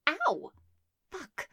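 loud high yelp then curse; yelp, then swear; sharp yelp and curse. Acted. Cry at spanking.

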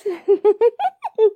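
high, loud burst of giggles; laugh. Acted. Laughs while asking for help.

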